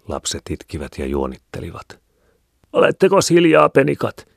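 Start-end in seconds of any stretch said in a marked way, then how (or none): 2.7-4.3 put-on high, loud scolding yell at children; woman's piercing high holler; shrill shout. A narrator's reading. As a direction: shriek at the kids to hush. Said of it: "huusi yksi vaimoista kimeällä äänellä".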